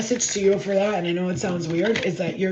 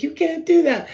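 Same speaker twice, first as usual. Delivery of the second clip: mock emotional protest; 10 semitones higher, 7 dB louder than usual.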